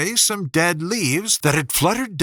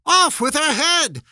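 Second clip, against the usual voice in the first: much higher, louder shriek; queen's shout; 11 semitones higher, 3 dB louder than usual. A narrator's reading. Queen ordering execution, high shouting shriek. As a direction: scream the order. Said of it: "shouted at the top of her voice".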